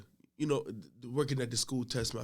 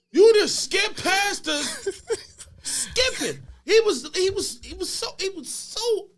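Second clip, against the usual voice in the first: high, loud, half-laughing rant; incredulous outburst; 9 dB louder than usual. Retold or imitated. Custodian telling how the boy came back skipping, incredulous shouting. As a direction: shout it in amused disbelief.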